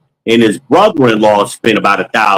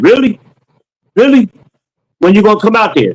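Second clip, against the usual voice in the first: eager customer; 9 semitones higher, faster than usual. Retold or imitated. A customer hearing about a cheap install asks eagerly, delighted and pitched high. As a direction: play the thrilled customer who can't believe the deal.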